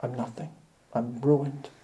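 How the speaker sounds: Isaiah and Job softly confessing ruin before the King; hushed confession. Acted. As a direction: breathe it out, undone. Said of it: "And yet they say".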